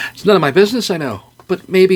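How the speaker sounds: a hint of a nosy barkeep; lightly voiced bartender; concerned bartender.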